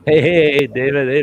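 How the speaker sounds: loud, high hey to someone arriving; calling out. Real, not acted. Greeting David as he walks in, calling out loud and high. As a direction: call out across the room to get his attention.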